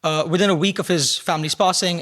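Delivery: ordinary delivery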